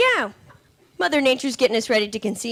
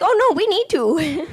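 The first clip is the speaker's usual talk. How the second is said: slow, higher put-on insistence; nail lady insisting; 5 semitones higher, slower than usual. Nail lady insisting on waxing, slow higher voice. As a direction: she won't take no, higher and slow. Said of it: "She's like".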